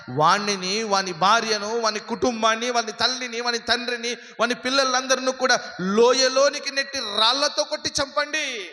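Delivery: preacher proclaiming a sentence of judgment; preaching crescendo. Acted. Preaching, acted divine command.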